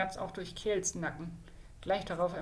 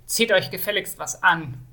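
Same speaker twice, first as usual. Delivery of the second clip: louder, barked command to get dressed; gruff order; 9 dB louder than usual. Acted. Kjeld scolding the naked mage, gruffly loud. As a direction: snap the order at him. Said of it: "Kjeld brummte".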